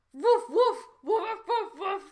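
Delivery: high, loud playful barking; yappy pretend woof woof; mock dog barking. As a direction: bark like a silly little dog. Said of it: "She a dog?".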